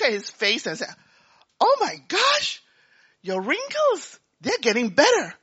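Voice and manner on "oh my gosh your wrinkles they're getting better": higher, slow gasp of wonder; stunned by what she sees; amazed. Retold or imitated. Wife sees wrinkles gone.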